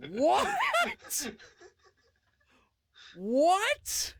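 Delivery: high, cracking cry of disbelief; hammed-up shocked shriek. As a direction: scream it like you can't believe it. Shocked at hole in backyard.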